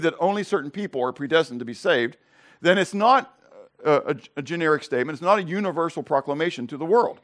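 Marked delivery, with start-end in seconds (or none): none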